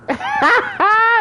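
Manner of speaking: high-pitched cackle; high laugh. Real, not acted. Streamer cackles, accusing the guest. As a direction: crack up high.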